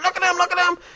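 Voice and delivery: high, loud amazed shrieks; excited imitation. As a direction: imitate her astonishment, crying out. Healed woman shows her hands.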